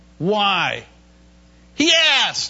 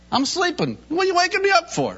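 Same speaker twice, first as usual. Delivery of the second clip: annoyed at being woken; 9 semitones higher than usual. Acted. Jesus woken in the storm.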